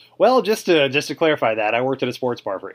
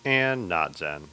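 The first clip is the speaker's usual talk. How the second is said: slow intro; 5 semitones lower, 5 dB quieter, much slower than usual. Acted.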